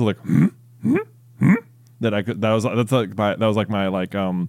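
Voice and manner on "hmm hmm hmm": short creature-like "hmm" grunts; Yoda harumph. Shown as a demonstration. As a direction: imitate a little questioning harumph, spaced out. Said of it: "like, harumph sound he does".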